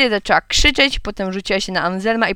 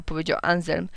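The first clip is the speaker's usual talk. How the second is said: usual speaking voice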